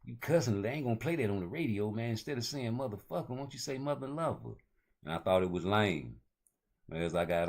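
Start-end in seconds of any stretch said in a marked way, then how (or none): 0.1-4.6 lightly voiced uncle, pitched a bit higher, advising; a hint of a fatherly uncle giving a tip; imitating Uncle Reggie. Uncle tells his cussing nephew to clean up lyrics, lightly voiced. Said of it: "He was like".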